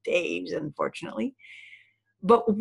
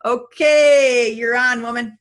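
loud, higher handover call; big, raised-voice cue to a colleague; 3 semitones higher, 9 dB louder, slower than usual. Real, not acted. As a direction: announce the next speaker with punch. Hands over to next presenter.

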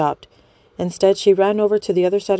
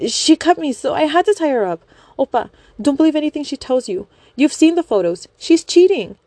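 high, loud frantic accusation; shrill desperate lying; high frantic fangirl; 9 semitones higher, 4 dB louder than usual. Acted. Young woman accusing wife.

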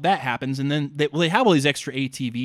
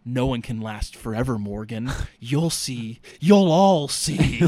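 slow, louder hammed-up villain; mock villain gloat; 3 dB louder, slower than usual. [name] gloating at Morgan, hammed-up villain. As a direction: cackle it like a cartoon baddie.

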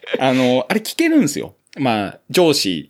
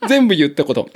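normal talking voice

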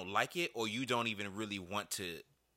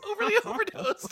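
laughing; 7 dB louder than usual. Real, not acted. Teasing a mangled album title.

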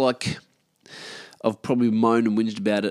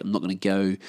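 normal talking voice

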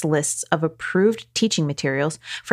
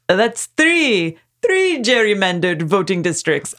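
puppet voice; 3 semitones higher, 4 dB louder than usual. Pretend. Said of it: "I was gonna go with".

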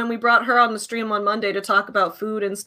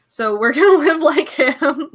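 laughing; 5 semitones higher, 5 dB louder than usual. Real, not acted. Laughs through the joke.

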